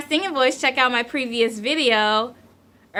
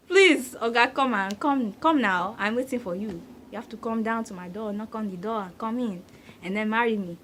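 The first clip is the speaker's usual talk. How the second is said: put-on playful Nigerian-accented plea, trailing softer; mock pleading in a Nigerian accent; 4 dB quieter than usual. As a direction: beg jokingly in a Nigerian accent.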